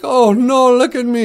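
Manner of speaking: high, loud mock wail; tearful dismay. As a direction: wail in hammed-up horror at yourself. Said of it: "And he starts to cry".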